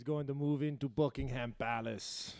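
softly tossed-off attempt at a Schwarzenegger-like accent; muddled, vaguely Austrian accent; botched mystery accent. Podcaster opening the show with a joke, in a botched accent. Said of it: "what was that accent that I just did?".

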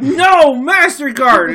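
high, loud, slow drawn-out MasterCard; hammed-up stubborn tourist; mock defiance. Tourist refusing the clerk, loud slow mock defiance. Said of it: "I was like".

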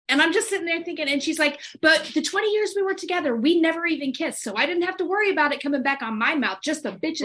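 can't wait to reach the punchline; gleeful gossip about the hairdresser; excited retelling. Retold or imitated.

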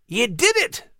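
high, excited character voice; barked cheer. A narrator's reading. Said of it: "Max barked".

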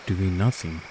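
plain reading voice

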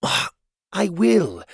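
a hint of a bright young helper; Cogleen's voice. Cogleen's voice in story.

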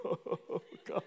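breathy, sobbing mock cry; mock weeping. Hiding behind someone, mock weeping. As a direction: imitate crying with a laugh in it. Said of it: "ugly cry weep".

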